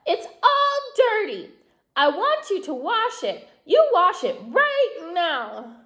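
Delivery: very high shriek on "My dress", then bossy; a tantrum over a dirty dress; doll shrieking. A narrator's reading. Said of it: "started to scream".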